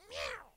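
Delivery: high squeaky mock meow; a loud cartoon cat cry. Cat sound once more.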